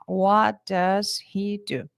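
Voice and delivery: What does he do? said slowly, each word separate; careful English. Shown as a demonstration. Teacher showing students the slow separate version. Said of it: "por exemplo, que é assim".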